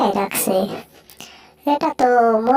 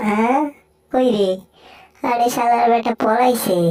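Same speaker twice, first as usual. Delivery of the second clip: lower, grumbling cat voice, threatening then coaxing; gruff cartoon cat; 4 semitones lower than usual. Cat threatening the mouse who hurt his friend, low gruff voice. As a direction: growl like a sulky tomcat, lower.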